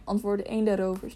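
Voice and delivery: ordinary delivery, nothing marked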